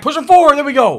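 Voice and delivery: real urging shout; excited urging. Real, not acted. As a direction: yell the pile forward. Urging tackle forward.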